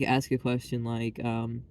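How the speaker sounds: ordinary delivery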